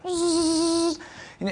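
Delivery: high, steady motor whine; sustained mechanical whirr made by mouth; imitated motor noise. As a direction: mimic a power trim motor humming. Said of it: "hear a noise like this".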